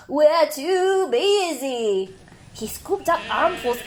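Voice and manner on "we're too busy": slow bleating sheep. Acted. Sheep voice in story.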